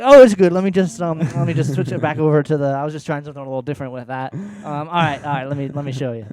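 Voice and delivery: high, loud and fast flustered cover; flustered and caught out. Retold or imitated. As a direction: scramble to cover up, embarrassed. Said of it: "And I was like".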